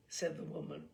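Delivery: ordinary delivery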